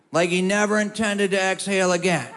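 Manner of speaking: ordinary talk, nothing marked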